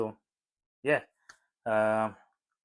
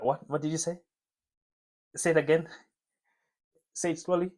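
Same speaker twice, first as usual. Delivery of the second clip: puzzled asking again; 6 semitones higher than usual. Retold or imitated. Mimics confused listener asking again.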